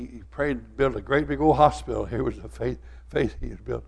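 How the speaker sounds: ordinary delivery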